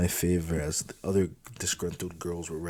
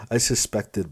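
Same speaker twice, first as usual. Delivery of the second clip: ordinary delivery, nothing marked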